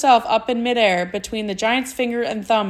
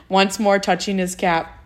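ordinary delivery

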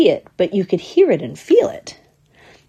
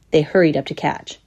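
ordinary delivery

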